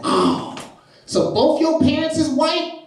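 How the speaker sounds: put-on slow, high-pitched kid's question; seventh grader. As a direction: imitate a kid working it out, voice rising high. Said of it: "I had a seventh grader".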